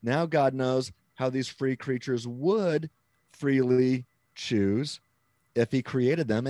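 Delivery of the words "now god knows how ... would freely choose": WOULD drawn out, then slow and loud; slow, emphatic "would"; stress on WOULD. Shown as a demonstration. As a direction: lean hard on "would", spacing what follows. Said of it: "I didn't use the word could".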